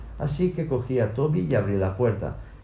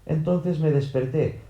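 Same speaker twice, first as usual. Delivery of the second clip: ordinary delivery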